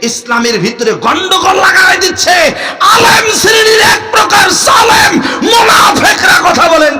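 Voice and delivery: high, loud, slow preaching cry; full-force sermon outcry against hypocrites; fiery preaching. Acted. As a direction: thunder it at the crowd.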